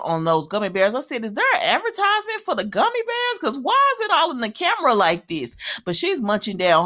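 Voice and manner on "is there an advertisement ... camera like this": high-pitched incredulous question; mock incredulity. Host questioning gummy bears on camera, high mock incredulity. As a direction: question it in playful disbelief, pitched way up. Host mocks blatant product placement.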